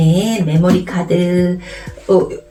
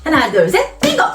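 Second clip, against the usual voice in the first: game call; 7 semitones higher than usual. Shown as a demonstration. Models the bingo call for children.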